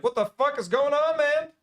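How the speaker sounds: high, loud mock yell; retold yell. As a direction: replay the shout at the neighbour. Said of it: "he must have heard me yell".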